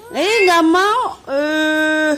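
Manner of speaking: high, loud mock whiny baby voice; voicing the baby. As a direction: pretend to be the baby who won't. Voicing the baby's refusal.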